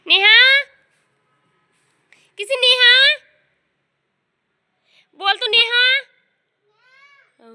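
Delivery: high, loud sing-song questions and rising calls. Real, not acted. Mother drills baby on words.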